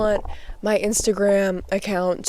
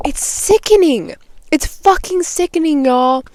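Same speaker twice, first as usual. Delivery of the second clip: much higher, indignant; disgusted outburst; 8 semitones higher than usual. Real, not acted. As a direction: spit out the disgust. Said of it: "I'm getting heated".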